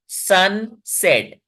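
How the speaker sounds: सनसेट in two parts, SUN then SET; syllable by syllable. Shown as a demonstration. Teacher counting syllables for the class, सनसेट broken in two. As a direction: say सनसेट slowly, split into two separate beats. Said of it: "ये बाकी जो है उनका मैं बता दे रहा हूँ कितना है".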